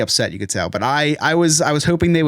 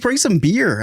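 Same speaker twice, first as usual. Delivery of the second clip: high voiced demand; 9 semitones higher, slower than usual. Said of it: "It's like".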